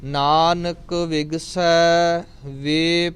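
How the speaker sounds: drawn-out, chanted reading of the line; slow devotional chant; slow scripture recitation. Acted. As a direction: recite it slowly and reverently. Recites gurbani slowly.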